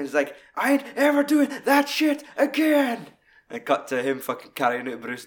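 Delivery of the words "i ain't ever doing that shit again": high, loud furious complaint; raging vow. Retold or imitated. Obi raging after carrying the body, high loud rant. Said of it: "it was the rage when he came in".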